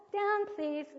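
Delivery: put-on high, sing-song cue to a toddler; high-pitched parent prompting a baby; modelled parent's prompt. Shown as a demonstration. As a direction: pitch it up, clear and even. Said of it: "you're gonna say".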